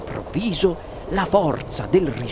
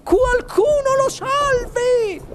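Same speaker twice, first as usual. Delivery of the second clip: very high, slow wail; panicked shriek; 4 dB louder, slower than usual. A narrator's reading. Mother begging someone to save her son, shriek. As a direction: scream for help in falsetto. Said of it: "strillò la signora Gloop".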